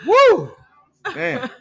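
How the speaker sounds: high, loud cry of relief; excited whoop. Real, not acted. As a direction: let out a happy whoop. Relief after fixing the sound.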